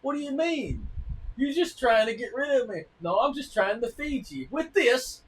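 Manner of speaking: high, loud bickering couple; squeaky indignant back-and-forth; husband and wife squabbling over pancakes, high and loud; mock sitcom bickering. Acted sitcom quarrel.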